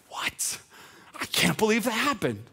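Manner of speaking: hushed gasped question, then high amazement; stunned disbelief. Acted. Disciples seeing the storm stopped, stunned disbelief. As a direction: act out astonished onlookers.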